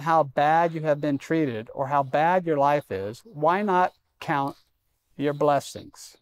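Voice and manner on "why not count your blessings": slow emphasis. Acted. Preacher urging the congregation.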